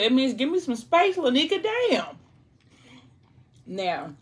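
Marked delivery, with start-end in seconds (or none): 0.0-2.2 fed-up husband losing it, pitch rising with each word; angry outburst. Retold or imitated. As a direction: let it build until you blow up at her. Angry husband demands space.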